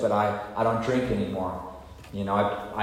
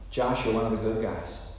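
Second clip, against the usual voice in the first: reassuring; 5 dB quieter than usual. Retold or imitated. Sponsor comforting him.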